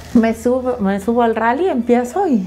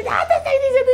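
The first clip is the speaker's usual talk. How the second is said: mock scolding husband; much faster than usual. Husband scolding after wrong lap.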